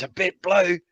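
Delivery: high, loud mock disbelief; imitating a shocked cop; incredulous echo. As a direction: echo it back like you can't believe it. Cop repeats phrase, incredulous.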